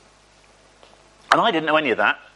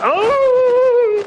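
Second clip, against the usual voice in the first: long high-pitched mock sob; imitated wail; 6 dB louder than usual. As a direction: imitate someone bawling, loud and drawn out. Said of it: "She was just going".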